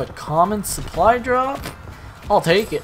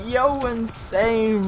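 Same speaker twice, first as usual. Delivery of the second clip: excited; 6 semitones higher, much slower than usual. Retold or imitated. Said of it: "I was like".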